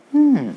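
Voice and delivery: a high, loud, hammed-up thinking hum; pondering hmm. Shown as a demonstration. Teacher teasing the class about a mystery, mock-pondering hum. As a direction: hum like a detective sniffing a mystery. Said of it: "The plot starts to thicken".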